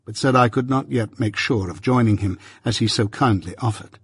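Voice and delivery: plain reading voice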